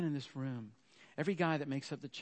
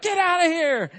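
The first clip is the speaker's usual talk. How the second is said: much higher and louder, shouted again; yelled order; 15 dB louder than usual. Retold or imitated. Dad yelling at his wife as she bursts in, shouting. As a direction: snap the order back at her full force. Husband yells at returning wife.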